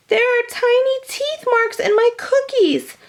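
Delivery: high, whining wail over the cookies. A narrator's reading. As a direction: wail it like a upset child. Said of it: "B B wailed".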